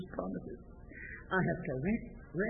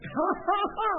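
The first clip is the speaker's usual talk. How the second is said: acted laughter; 11 semitones higher, 7 dB louder than usual. Shown as a demonstration. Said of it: "It means".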